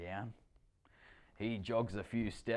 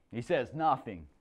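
ordinary delivery

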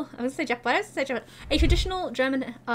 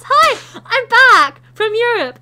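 excited greeting; 7 semitones higher, 13 dB louder, much slower than usual. Real, not acted. Energetic vlog greeting.

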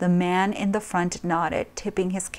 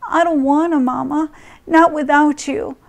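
put-on higher pleading child voice; frightened child; 7 semitones higher, 4 dB louder than usual. Little girl begging her mother, higher pleading child. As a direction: beg mama not to leave.

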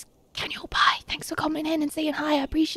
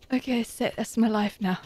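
ordinary talk

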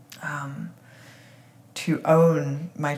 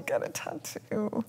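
whispered; 5 semitones higher, 9 dB quieter, slower than usual. Acted. Punchline of mock list.

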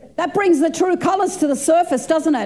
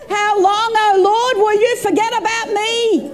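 pleading lament; 7 semitones higher than usual. Acted. Voicing a lament to God.

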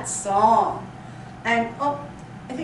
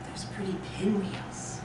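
soft whispered awe; hushed wonder; 3 semitones lower, 8 dB quieter than usual. Pretend. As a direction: breathe it out like a delighted secret.

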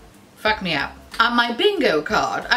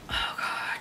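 whisper; 9 dB quieter than usual. Real, not acted. Gasp at cute compact.

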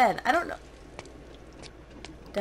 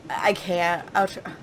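ordinary delivery

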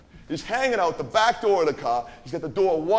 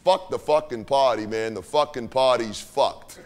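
low grumble; 6 semitones lower than usual. Retold or imitated. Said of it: "he just goes".

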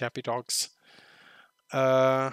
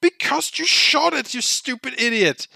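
exasperated yell; 7 semitones higher, 7 dB louder than usual. Real, not acted. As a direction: yell back at the screen in disbelief. Yelling at character.

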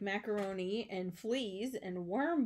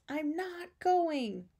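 very slow, drawn-out, high refusal; stubborn refusal; 7 semitones higher, much slower than usual. A narrator's reading. Arthur answers his coaxing parents about camp, stubbornly drawn out. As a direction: stretch every word and dig your heels in.

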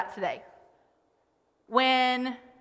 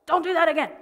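higher-pitched parental telling-off; acted scolding; 5 semitones higher, faster than usual. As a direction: scold a child, mid-story. Voices intended scolding of her kid.

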